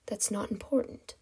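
soft, hushed dismissal; ghostly whisper. Acted. Kidnapper refuses captive his identity, ghostly whisper. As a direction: brush her off in a ghostly hush. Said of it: "the ghostly whisper informed her".

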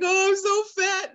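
high, drawn-out pretend wail; hammed-up crying to a husband; mock crying. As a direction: imitate a tearful whine, loudly. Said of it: "I was, like, crying".

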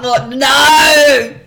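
loud, slow, crying out "no"; disgusted yell. Retold or imitated. Teacher recoiling from the soiled wet wipe, loud disgusted wail.

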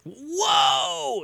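high, drawn-out "whoa" to a bolting horse; acted horse-calming shout. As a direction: holler a long, high-pitched whoa, play-acting. Cowboy reining in bolting horse.

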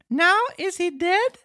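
very high puppet voice, mildly sad; Pinocchio, sorrowful. Acted. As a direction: cut in sadly as a squeaky little marionette. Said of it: "Pinocchio interrupted sorrowfully".

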